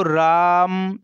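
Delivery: राम drawn out three times longer, like calling someone; drawn-out calling Ram. Shown as a demonstration. Teacher calling राम across a distance, long vowel. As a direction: call out राम with the आ stretched long. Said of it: "जैसे हम किसी को बोलेंगे तो".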